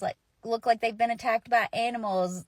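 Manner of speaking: ordinary talk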